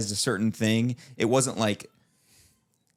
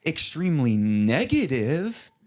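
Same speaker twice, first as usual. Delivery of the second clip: slow, drawn-out, high theatrical delivery; theatrical Dwight line; 6 semitones higher, much slower than usual. Retold or imitated. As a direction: ham it up like an over-acted TV line. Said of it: "says it in, like, a theatrical way".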